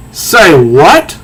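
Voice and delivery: a mock-outraged double take; incredulous. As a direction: throw it back in disbelief. Etta reacts to being refused.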